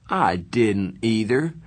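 slow, bored, tough-guy denial. A narrator's reading. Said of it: "in the bored, tough voice".

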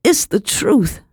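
high-pitched, urgent protest; blurting out. A narrator's reading. Girl interrupting her accuser, blurting out. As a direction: burst out in the girl's defence. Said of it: "I blurted out".

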